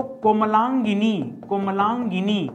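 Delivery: कोमलांगिनी spelled out slowly, each syllable apart; slow dictation. Shown as a demonstration. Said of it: "हर जगह गिनी नहीं होता है". (कोमलांगिनी धीरे-धीरे बोलना)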